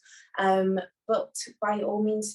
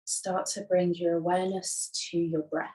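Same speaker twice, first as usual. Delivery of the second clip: unhurried, soothing yoga nidra voice; guided meditation. Acted. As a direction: guide them gently into rest, leaving long silences. Leads a guided relaxation.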